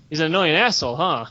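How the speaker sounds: low, knowing repeat; musing echo. Acted. Storyteller weighing what his guildmate just said, musing echo. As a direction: drop it low, mulling it over.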